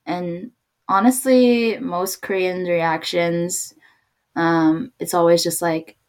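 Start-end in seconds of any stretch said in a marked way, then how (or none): none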